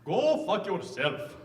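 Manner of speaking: very slow put-on Indian accent. Shown as a demonstration. Comic having the crowd guess accents, mock Indian accent.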